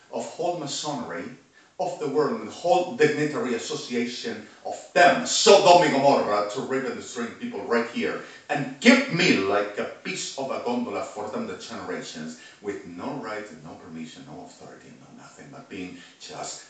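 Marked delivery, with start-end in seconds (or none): 0.1-10.6 shouting in rage, much louder; furious shout. Real, not acted. Ranting to the camera about his land, shouting furiously. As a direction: yell it out with fury.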